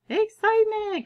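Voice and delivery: high, loud burst of excitement. Shown as a demonstration. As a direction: cheer it out for the class. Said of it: "read it with me with excitement".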